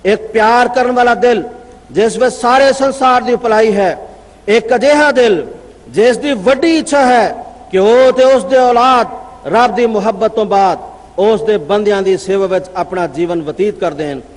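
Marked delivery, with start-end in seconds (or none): none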